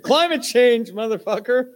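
loud high yell; yelled quote. Retold or imitated. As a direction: shout it out angrily. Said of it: "It's like".

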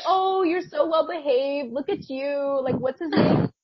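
cooing auntie. Retold or imitated. Cashier auntie cooing at dog.

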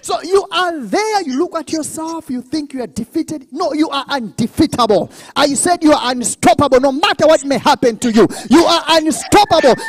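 high, loud, triumphant exhortation; preaching at full force. Real, not acted. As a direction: fire up the congregation with driving conviction.

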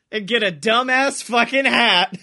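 usual speaking voice

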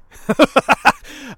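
loud, high-pitched laugh; real incredulous laughing fit; burst of laughter. Real, not acted. Laughs after retelling the news.

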